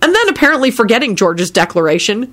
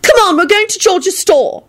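high, bossy urgency; high-pitched exasperated order; furious; 13 semitones higher than usual. A narrator's reading. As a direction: haul someone along crossly. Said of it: "told Elizabeth furiously".

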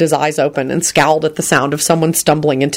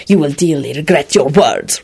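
lower-pitched menacing warning; hissed threat; 4 semitones lower than usual. A narrator's reading. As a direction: hiss it low as a threat. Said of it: "Radu hissed".

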